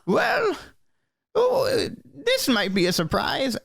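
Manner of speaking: put-on high-pitched elderly gnome voice; very old gnome. Old gnome surprised by a visitor, high elderly voice. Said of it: "You hear a very old gnome go".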